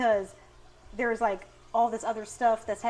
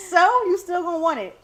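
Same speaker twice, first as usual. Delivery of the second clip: laughing; 9 semitones higher, 9 dB louder than usual. Real, not acted. Mocking the kid's tantrum.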